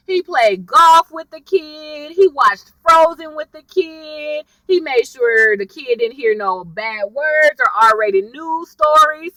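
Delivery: normal talking voice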